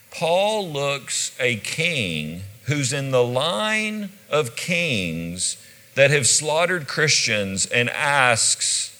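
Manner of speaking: ordinary talk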